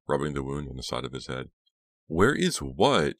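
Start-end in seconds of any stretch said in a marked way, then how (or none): 2.0-3.2 slow, loud, higher demand; insistent, drawn-out question. A narrator's reading. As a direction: stretch it out as a baffled demand. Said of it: "Jade demanded".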